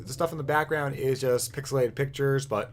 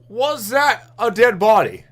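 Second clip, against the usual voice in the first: high, loud alarmed cry; alarmed shout; 10 semitones higher, 14 dB louder than usual. Real, not acted. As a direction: yell it out in shock. Repeats it, alarmed.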